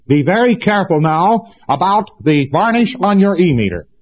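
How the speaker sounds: high-pitched, overemphatic parody of an instructor; mock lecturer. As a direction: make a trivial warning sound gravely important. Parodies teacher overstressing a trivial point.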